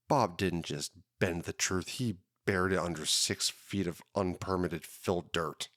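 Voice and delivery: hard-boiled detective voice-over, measured and moody; noir narration. Acted. Podcast host opening his cold case like a noir detective. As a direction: tell it like a tired private eye.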